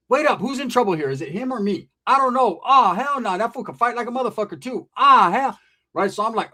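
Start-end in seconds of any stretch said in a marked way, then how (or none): none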